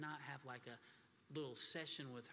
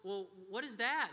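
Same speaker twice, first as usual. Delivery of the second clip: put-on curious child; 6 semitones higher, 7 dB louder than usual. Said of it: "she goes".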